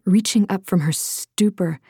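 plain reading voice

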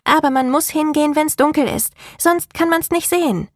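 put-on high, bright-eyed little girl. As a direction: speak as an eager young girl. Child Rebecca voiced by narrator.

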